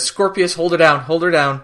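retold order to restrain someone, urgently. Retold urgent order during struggle.

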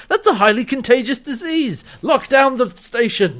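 high, urgent acted order; mock alarm. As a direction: imitate an alarmed official sounding the lockdown. Acted doctor ordering a lockdown.

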